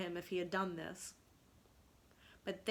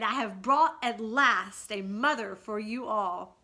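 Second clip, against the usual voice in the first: excited; 9 dB louder than usual. Acted. Peter announcing proudly.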